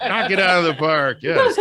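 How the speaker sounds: high, loud cheer through laughter; big playful celebratory shout; laughing cheer. Real, not acted. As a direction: whoop it out, laughing. Joking cheer after friend's plug.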